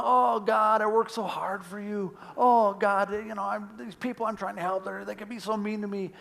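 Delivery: mock whining prayer. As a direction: whine a complaint up to God. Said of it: "join me on my whining".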